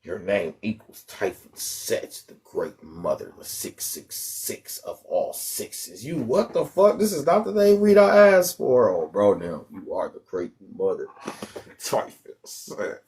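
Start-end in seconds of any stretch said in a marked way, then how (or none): 0.0-6.0 low, slow, whispery dark-prophet voice; hammed-up occult reading, soft and breathy; spooky oracle voice. Mock spooky spiritual name read. 8.9-13.0 hammed-up occult reading, soft and breathy; spooky oracle voice. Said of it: "why are you talking like that".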